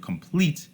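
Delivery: "complete" with the second syllable stressed. Shown as a demonstration. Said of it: "the second syllable is stress".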